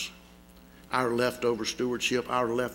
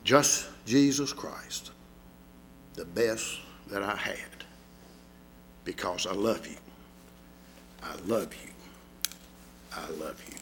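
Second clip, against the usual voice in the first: softer and lower, fading each I love you; tender hushed refrain; 6 semitones lower, 5 dB quieter than usual. Acted. Preacher voices Christ's love to the congregation, tender and hushed.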